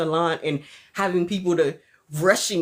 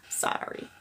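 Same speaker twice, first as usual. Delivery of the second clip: whispered sorry; 10 dB quieter than usual. Real, not acted. Apology fading.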